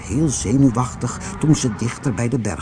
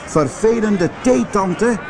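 high, mildly cross townspeople; calling out; 9 semitones higher than usual. A narrator's reading. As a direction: cry out at the aunt in a raised pitch. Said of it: "riepen de mensen". (licht verwijtend roepen)

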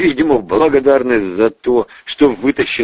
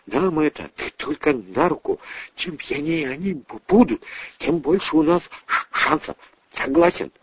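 quiet stammering soldier; 6 semitones higher, 5 dB quieter than usual. Acted. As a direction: stutter softly through every word.

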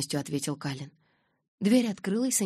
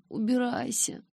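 moaning; 8 semitones higher, slower than usual. A narrator's reading. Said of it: "застонала я".

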